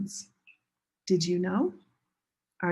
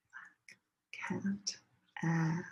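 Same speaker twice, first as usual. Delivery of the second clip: whisper; 3 semitones lower, 11 dB quieter, slower than usual. Shown as a demonstration. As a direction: barely voice it, let the class lead. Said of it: "second time say it together with me".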